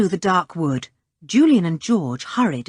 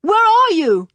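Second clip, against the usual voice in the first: calling out; 9 semitones higher, 5 dB louder than usual. Acted. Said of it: "yelled Julian".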